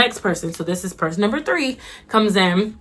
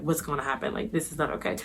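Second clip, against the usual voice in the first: worn-out worry; 4 semitones lower, 12 dB quieter than usual. Retold or imitated. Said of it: "I was crying".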